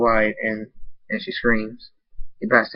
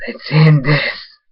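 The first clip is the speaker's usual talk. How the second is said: menacing declaration; 5 semitones higher, 7 dB louder, much slower than usual. Acted. Villain's final blow.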